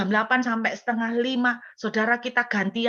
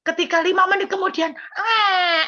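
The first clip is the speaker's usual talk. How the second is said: alarmed yelp; 9 semitones higher, 4 dB louder than usual. Retold or imitated. Baby cries again, teller yelps.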